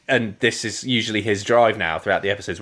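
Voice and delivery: ordinary talk, nothing marked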